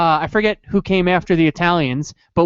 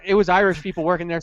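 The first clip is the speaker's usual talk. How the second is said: ordinary delivery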